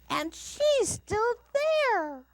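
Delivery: high, loud, slow little bear cry; baby bear voice. A narrator's reading. Little Bear discovers the girl in bed, high loud cry. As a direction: cry it out shocked, stretching THERE. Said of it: "in his baby bear voice".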